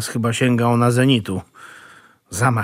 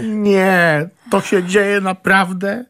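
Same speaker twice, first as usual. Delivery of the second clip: higher, slowed disbelief; incredulous; 6 semitones higher, much slower than usual. Acted. Nephew reacts to uncle's royalist talk, incredulous. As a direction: say it as if it cannot be true. Nephew cannot believe the news.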